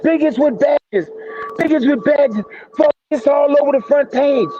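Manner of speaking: high-pitched, slowed cry of disbelief, imitated; imitating scared, breathless. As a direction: mimic his frantic news from the phone. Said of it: "you had to hear the way this dude sounded".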